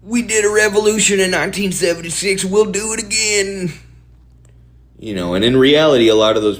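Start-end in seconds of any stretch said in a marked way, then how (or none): 0.0-3.7 defiant caricature American gun owner; mock American. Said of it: "versus Americans who are like".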